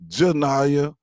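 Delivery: loud, high call of a name; indignant yell from another room. Retold or imitated. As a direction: shout it from down the hall. Daughter yelling name from other room.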